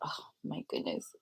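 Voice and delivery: breathless low whisper of dismay. Retold or imitated. Watching her dad walk, she whispers in dismay. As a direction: barely breathe the words. Seeing dad move, overwhelmed.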